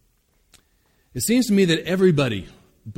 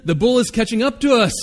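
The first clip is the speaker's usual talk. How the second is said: urgent pleading; 8 semitones higher, 6 dB louder, faster than usual. Acted. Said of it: "implored his companion".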